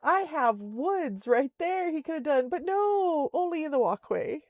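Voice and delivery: high, disbelieving complaint; why there of all places; venting about the moose, exasperated; exasperated rant. Real, not acted.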